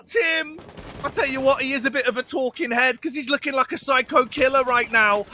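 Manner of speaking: high, near-shouting hype call; excited caster. Acted. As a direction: shout over the action. Live esports play-by-play.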